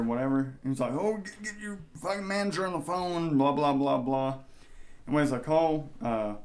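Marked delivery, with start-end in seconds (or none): 0.9-4.4 high-pitched imitation of a ranting customer; mock irate customer. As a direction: rant like a customer losing it, without shouting. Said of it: "he's losing his fucking mind".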